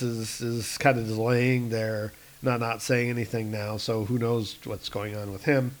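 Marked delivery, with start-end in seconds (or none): none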